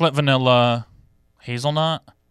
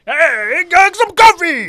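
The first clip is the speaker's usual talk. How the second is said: very high, loud, slow Viking bellow; mock Viking shout; 6 dB louder, much slower than usual. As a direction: roar it like a hammed-up Viking. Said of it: "That's how I just picture they wake up in the morning".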